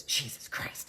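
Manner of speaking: imitating exasperation in a voiceless undertone; frustrated mutter. Retelling the defense attorney's outburst, muttered in frustration. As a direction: whisper it as a retold frustrated curse. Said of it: "you could tell he was frustrated".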